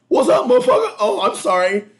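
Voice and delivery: loud, high challenge flipping to apology; hammed-up aggressor backing down; imitating heated confrontation. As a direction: burst in yelling, then squeak a sorry. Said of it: "her rushing back going".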